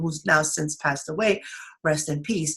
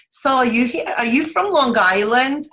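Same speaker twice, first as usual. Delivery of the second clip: higher, louder Long Island accent; 5 semitones higher, 4 dB louder than usual. Shown as a demonstration. Host demonstrating how Long Islanders ask, Long Island accent. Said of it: "That's the Long Island accent".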